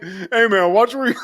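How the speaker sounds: high, loud nerdy protest. Retold or imitated. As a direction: imitate a nerd picking a fight, breaking into laughter. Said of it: "it's so fucking nerdy".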